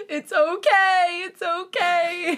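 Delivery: high, slow, drawn-out wail; stretched-out weepy squeal; tearful reassurance. Real, not acted. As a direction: comfort through laughter and tears. Consoling while both tear up.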